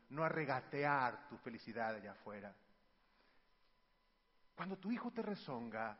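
ordinary delivery

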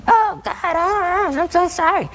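high, sneering mimic of a sorry prayer; mock the prayer in a high taunting voice; demon's mocking falsetto. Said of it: "I heard a voice mocking me".

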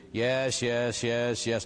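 level, evenly repeated yeses; rote answers. Retold or imitated. Penitent answering Father's list of questions, rote. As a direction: rattle off yes after yes like a checklist.

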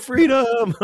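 a high, hammed-up rallying cry; William Wallace battle cry. Priest shouting a mock William Wallace battle cry. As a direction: belt it like a movie hero charging into battle. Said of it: "Are you William Wallace".

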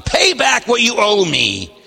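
lightly voiced angry demand. A narrator's reading. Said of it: "he demanded".

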